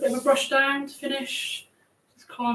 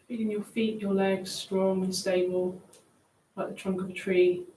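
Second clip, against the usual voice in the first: guided meditation; 5 dB quieter than usual. Acted. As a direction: ease them down gently. Leads a grounding meditation.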